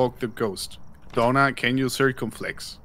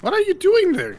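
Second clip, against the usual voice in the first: high, loud startled yelp; startled shout; 8 dB louder than usual. Real, not acted. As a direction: jump and shout in surprise. Startled by friend in room.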